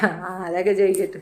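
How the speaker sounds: ordinary talk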